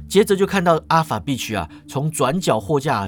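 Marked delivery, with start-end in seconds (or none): none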